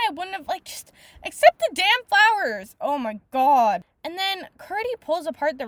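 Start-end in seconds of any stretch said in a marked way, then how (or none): 0.6-2.7 loud, raised-pitch exasperation; exasperated. Real, not acted. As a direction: burst out at the character, fed up. Exasperated at the character.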